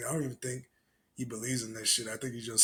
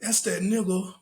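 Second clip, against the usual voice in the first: lightly imitated Australian accent, higher and louder; mock Australian accent; 7 semitones higher, 5 dB louder than usual. Streamer mimicking the Australian caller in a mock Australian accent. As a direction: parrot the clip in a jokey Australian accent. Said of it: "It sounds like an Australian accent".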